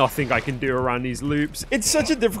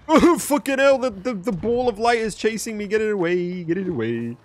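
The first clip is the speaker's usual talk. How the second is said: phobic panic; 6 semitones higher than usual. Real, not acted. Ball of light chasing him.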